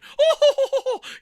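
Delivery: loud, high burst of laughter; hearty high laugh; big laugh. Retold or imitated. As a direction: laugh hard at the joke. Presenter joke, audience laughing.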